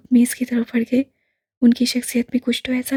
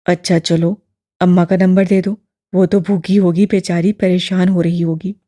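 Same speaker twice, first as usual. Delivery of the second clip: put-on low, louder elderly man, gently probing; lower, fuller old gentleman; old imam voice; 5 semitones lower, 6 dB louder than usual. A narrator's reading. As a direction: voice the old man, kindly and curious. Old man asks for mother.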